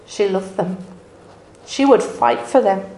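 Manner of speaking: normal talking voice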